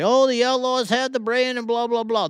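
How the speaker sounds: high, sneering mimic of the Outlaws crowd; mock them with a high, smug voice; mocking their boast. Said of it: "these people could say".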